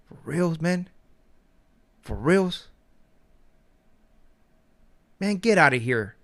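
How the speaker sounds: incredulous. Retold or imitated. Incredulous reply to moocher.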